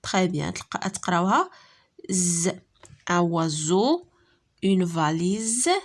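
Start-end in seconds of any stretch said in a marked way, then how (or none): 2.1-2.5 "ز" as a held buzzing Z sound; S read as Z. Shown as a demonstration. Teacher answers how S between vowels is read, sustained Z sound. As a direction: sound out "ز" alone, a sustained voiced zzz. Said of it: "غادي تقراوها".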